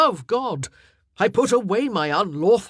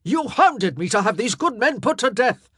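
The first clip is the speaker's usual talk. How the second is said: loud, higher accusation; accusing; 7 semitones higher, 9 dB louder than usual. Acted. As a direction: throw it in her face. King still raging at queen.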